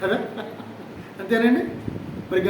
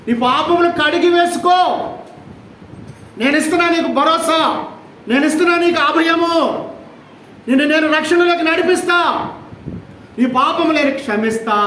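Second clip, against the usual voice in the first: higher, declaiming God's promise; preaching at full force; 7 semitones higher, 3 dB louder than usual. Acted. Preacher voicing God's assurance to the sinner, fervent preaching. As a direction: proclaim it like a solemn vow.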